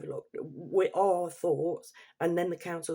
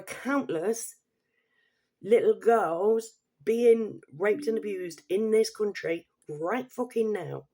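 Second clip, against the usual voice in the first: slow, emphatic, raised-pitch anger; real outrage; 3 semitones higher, 5 dB louder, slower than usual. Real, not acted. Vlogger raging about abuse in this country, outraged. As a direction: drive each word out slowly, higher and angry.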